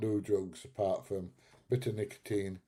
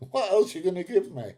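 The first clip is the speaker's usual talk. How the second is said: put-on cheeky child; 10 semitones higher, 9 dB louder than usual. Child answering mum back.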